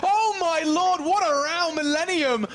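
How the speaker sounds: loud, very high exclamation; ecstatic outburst. Real, not acted. Caster celebrates Millennium's last-second round, ecstatic. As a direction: belt it out at the climax.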